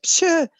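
loud, high-pitched "psha" scoff; mock scoff. Storyteller recalling their reaction to the movie, scoffing. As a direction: burst out with a high dismissive noise.

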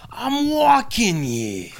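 high, loud, drawn-out mock outrage; big hammed-up street shout; New York catchphrase yell. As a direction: holler it slowly at a cab driver. Quoted New York catchphrase, acted out.

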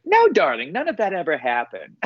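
high, breezy grande dame dismissal; airy showbiz darling. Retold or imitated. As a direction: toss it off like a diva. Imitating [name]'s grand reply.

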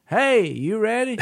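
high, bright shout-out across the store; cheery call out. Retold or imitated. Customer calling across the store to the cashier, high and cheery. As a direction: call out friendly and high to the clerk. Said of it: "I'm like".

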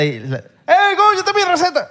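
high, put-on shout of the catchphrase; mock a stranger yelling it at him; imitating a fan on the street. Cohost imitating fans yelling at the guest, mock shout. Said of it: "Cada cuánto te dicen en la calle".

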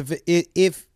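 ordinary talk

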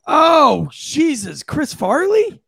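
loud, high gasp of recognition; astonished. Real, not acted. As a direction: blurt the name in amazement. Recognizes the hat's owner.

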